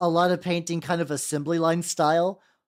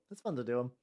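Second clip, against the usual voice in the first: softer repeat; 5 semitones lower, 10 dB quieter, faster than usual. Shown as a demonstration. Streamer echoing himself, much softer and in a put-on voice. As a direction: repeat "It's fun to do 'em" much softer, in an odd voice. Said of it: "It's fun to do 'em".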